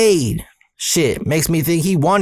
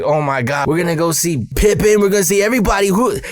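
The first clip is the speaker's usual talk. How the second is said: put-on building higher and faster, reliving a kid's thrill; retold excitement; 5 semitones higher, faster than usual. Boy learning he's going to the All-Star Game, retold excitement. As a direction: gush with mounting excitement, speeding up at the end. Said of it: "Oh, I got so excited".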